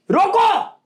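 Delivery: high falling call; mock alarm. Preacher acting out bandit's approach, mock alarmed call. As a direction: call out a high-pitched order to halt.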